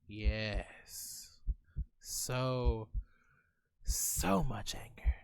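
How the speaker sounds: whispered, slow and heavy. Acted. Reading game text dramatically.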